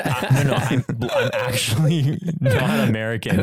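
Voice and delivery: laughing through a retold protest; amused retelling. Retelling the actor's protest to co-hosts, laughing.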